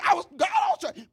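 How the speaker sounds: very high, loud cry to God; exasperated wail. Pretend. Joseph cries out high and loud after being lied about. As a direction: wail in comic despair. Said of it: "You're like".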